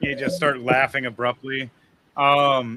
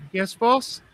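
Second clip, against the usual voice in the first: timid waitress; 9 semitones higher than usual. Acted. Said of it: "looks back over at the boss and goes".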